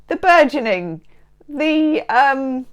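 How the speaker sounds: normal talking voice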